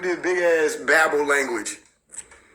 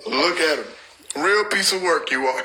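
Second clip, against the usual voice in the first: normal talking voice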